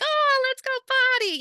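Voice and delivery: very high, loud sing-song; approaching an elder with babyish sing-song; elder speak. Retold or imitated. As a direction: coo like to a toddler. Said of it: "if I was approaching you".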